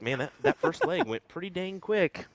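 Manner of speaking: mildly wowed; impressed reaction. Retold or imitated. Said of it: "I was like".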